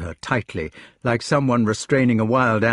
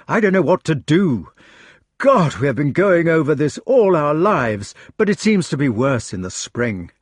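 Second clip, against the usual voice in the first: raised, higher-pitched desperation; desperate; 5 semitones higher, 5 dB louder than usual. A narrator's reading. Lancelot pleading with Jenny to come away, desperate. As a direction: plead urgently, louder and pitched up. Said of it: "he said desperately".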